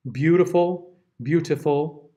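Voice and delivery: usual speaking voice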